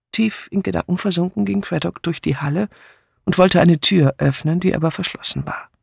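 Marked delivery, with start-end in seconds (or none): none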